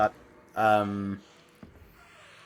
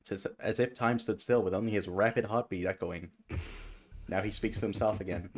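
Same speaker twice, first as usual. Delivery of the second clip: story narration; 5 dB quieter than usual. Acted. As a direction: read it like a dark tale. Reads the game's narration.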